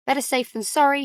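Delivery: plain reading voice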